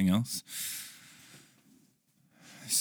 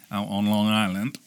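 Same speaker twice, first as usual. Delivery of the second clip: put-on Long Island accent. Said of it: "which is how they talk out there".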